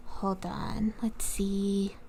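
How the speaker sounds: whispered under the breath; quietly whispering to self. Real, not acted. Whispers while fixing setup.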